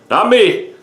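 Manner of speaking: playful exclamation. Real, not acted. Joking exclamation after "not me.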